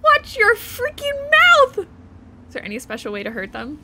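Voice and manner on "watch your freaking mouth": high, loud, slow hammed-up screech; mock-outraged shriek at a swearing kid. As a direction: screech the scolding like a shocked parent. Scolding kid again, louder.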